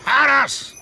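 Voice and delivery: sharp, high, loud call of the horse's name. Acted. Driver calling his horse Harras in the snow, loud high shout. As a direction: yell it so the horse hears you over the snow.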